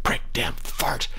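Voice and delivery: whispered curses; very quiet muttering. A narrator's reading. Father really mad, muttering to himself, whispered. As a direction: mutter each word under your breath in a whisper. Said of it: "would very quietly mutter truly obscene".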